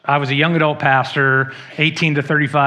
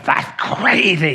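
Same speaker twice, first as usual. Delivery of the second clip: brother's version; 3 semitones higher, slower than usual. Retold or imitated. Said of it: "And so he would go".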